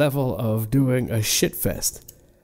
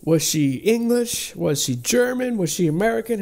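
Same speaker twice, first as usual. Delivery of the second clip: mock incredulous questions; 7 semitones higher than usual. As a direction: needle the accent with each question. Mocking the actress's shifting accent.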